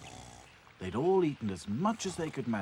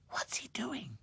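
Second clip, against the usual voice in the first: high, breathless whisper; 12 semitones higher, 4 dB quieter, slower than usual. Acted. Princess whispering from the cupboard. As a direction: whisper so he can't hear. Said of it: "whispered Princess".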